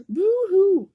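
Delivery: very high, loud boo-hoo; mock wail. Owl fleeing the Gruffalo, hammed-up loud wail. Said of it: "he said".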